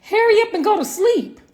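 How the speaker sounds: high, impatient urging. Retold or imitated. Wife silently urging husband to sleep, high and impatient. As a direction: hurry him along.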